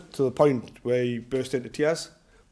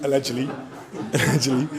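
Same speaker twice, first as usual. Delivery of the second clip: laughing; faster than usual. Real, not acted. Joking about a crime.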